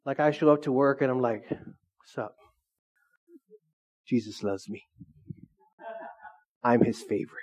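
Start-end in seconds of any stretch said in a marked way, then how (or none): 1.5-7.4 arrogant. Acted. Preacher mimicking an arrogant believer at work, smug and low. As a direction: act smugly superior, voice dropped low. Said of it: "walk around arrogant".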